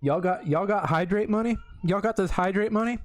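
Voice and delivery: high hyped-up shout; excited. Pretend. Mock hype about chat donations.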